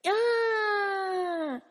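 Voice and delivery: long, high drawn-out "ah"; exasperated cry. Pretend. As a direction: let out a sustained high wail of mock despair. Exasperated cry about classmates' reaction.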